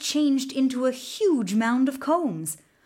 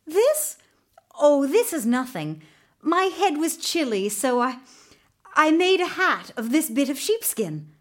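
nervous excuse; 5 semitones higher, 4 dB louder than usual. Acted. Boy nervously hides his gold hair.

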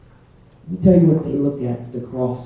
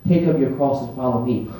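measured, matter-of-fact instruction; calm command; 3 semitones lower, 3 dB quieter than usual. Acted. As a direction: state it quietly as Jesus would. Jesus's calm answer, then echoed incredulously.

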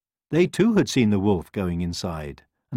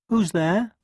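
high squeaky hen voice; a nervous little hen; 12 semitones higher, 4 dB louder than usual. A narrator's reading. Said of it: "asked the hen".

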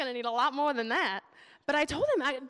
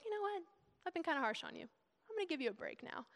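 lightly voiced God, much softer; mock casual God; 5 semitones higher, 8 dB quieter, faster than usual. God tells people he'll give them a break, casual and quiet. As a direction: drop to a quiet, offhand aside.

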